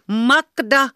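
high, loud shouted name. A narrator's reading. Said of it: "yhteen ääneen, mutta vielä kovemmin".

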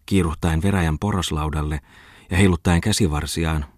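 usual speaking voice

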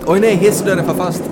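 high, very loud mock panic; hammed-up alarm. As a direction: cry out in pretend emergency at full volume. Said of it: "Jag gör det en gång till".

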